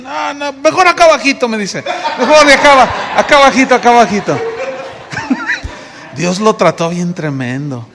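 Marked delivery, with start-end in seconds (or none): 0.0-4.4 higher, louder with laughter; laughing refusal. Retold or imitated. Brother declining to preach, laughing. 4.4-6.1 breathless squeal of laughter; high airy laugh. Real, not acted. Pastor laughing at his own story, high airy laugh.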